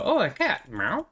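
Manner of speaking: a gentle grown-up cooing at a pet; mild adult coo. Retold or imitated. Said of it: "the adults and they go".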